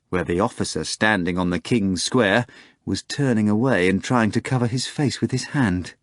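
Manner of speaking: normal talking voice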